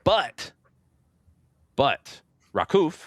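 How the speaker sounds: normal talking voice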